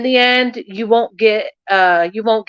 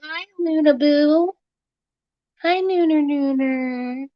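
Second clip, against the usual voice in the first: high, cooing pet voice; 7 semitones higher, 4 dB quieter than usual. Real, not acted. Owner greets her cat Luna, high cooing baby talk.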